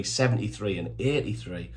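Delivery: ordinary talk, nothing marked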